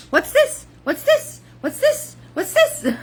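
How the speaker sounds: imitating Jack's high, wide-eyed wonder; Jack Skellington wonder. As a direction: gasp it out like a kid discovering Christmas. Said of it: "he acts like a little kid in the movie".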